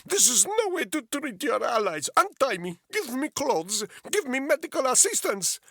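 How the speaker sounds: put-on high, loud Russian-accented orders; furious prisoner shouting at captors; angry demands. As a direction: bark the demands in a thick accent.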